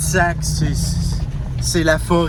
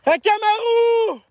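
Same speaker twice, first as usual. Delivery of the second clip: high, drawn-out call across the woods; long high hail to a lost dog; slower than usual. Real, not acted. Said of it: "ça fait une heure que je crie".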